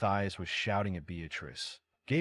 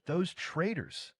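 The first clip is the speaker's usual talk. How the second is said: slow, higher scream of fury; 8 semitones higher, slower than usual. A narrator's reading. Queen mother learns officials betrayed her, screaming slowly. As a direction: spit it out slowly at the traitors. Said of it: "Beatrice screamed".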